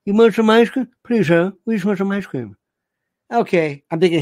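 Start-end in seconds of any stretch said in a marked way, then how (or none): 0.0-0.9 sneering bully. Retold or imitated. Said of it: "So he says". 1.0-2.6 put-on underbite kid voice. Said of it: "he says".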